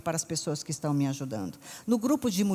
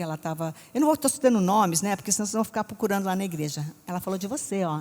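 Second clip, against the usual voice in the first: normal talking voice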